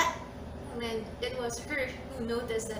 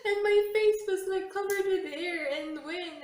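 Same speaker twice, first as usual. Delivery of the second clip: laughing; 8 semitones higher, 5 dB louder than usual. Real, not acted. Laughing about windy face.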